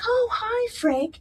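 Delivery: high, cheery hello to an acquaintance; friendly surprised greeting. Retold or imitated. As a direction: imitate a delighted, high-pitched greeting. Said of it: "and she's like".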